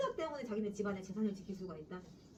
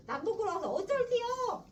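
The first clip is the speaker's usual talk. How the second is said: raised, louder, higher scolding; bully him into shame; browbeating old man; 9 semitones higher, 5 dB louder than usual. Acted. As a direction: grandfather yelling at his grandson in dialect. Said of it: "윽박을 지르고 있어".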